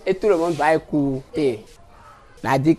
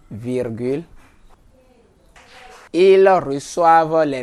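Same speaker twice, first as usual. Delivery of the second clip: lent, par morceaux, chaque phrase répétée; dictating; slower than usual. Acted. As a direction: dicter mot à mot pour la classe. Dicte lentement en répétant. (voix de dictée, lente et répétée)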